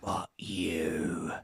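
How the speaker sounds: low, deadpan brush-off; cold security guard. Retold or imitated. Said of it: "the security guard went".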